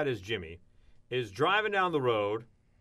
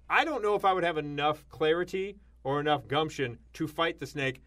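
ordinary delivery

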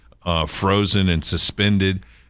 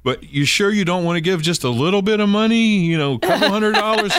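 higher put-on scammer voice, no accent; imitated scammer voice; 12 semitones higher than usual. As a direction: voice the pushy scammer up high, laughing. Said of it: "I'm not gonna do the accent".

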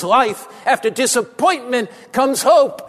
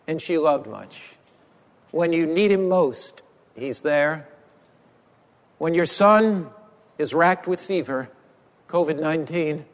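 hushed tender preaching; 6 semitones lower, 4 dB quieter than usual. Acted. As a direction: lean in and speak gently of comfort. Soft intimate preaching passage.

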